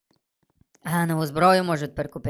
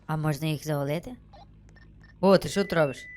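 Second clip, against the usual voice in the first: ordinary talk, nothing marked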